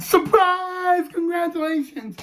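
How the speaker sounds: imitated chorus of surprised congratulations; surprise party cheer. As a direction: call it out high like party guests, without full volume. Party crowd greeting him in story.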